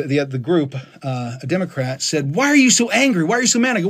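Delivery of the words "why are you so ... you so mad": high-pitched mock challenge; mock accusing. Podcast member challenging a rant, mock accusing. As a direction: imitate a worked-up critic demanding answers. Imitates Democrat's accusing question.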